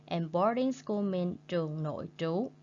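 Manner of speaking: ordinary talk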